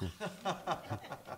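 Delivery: squeaky high laugh; high cackle. Acted. Weather host entrance. (rire aigu de dessin animé)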